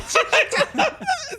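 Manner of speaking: high-pitched real laugh; helpless high laughter. Real, not acted. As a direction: crack up at your own story. Laughs at own story.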